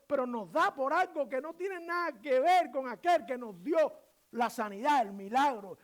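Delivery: impassioned, high and loud sermon delivery; full-force preaching. Real, not acted. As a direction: drive each phrase home to the congregation.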